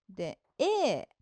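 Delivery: ordinary talk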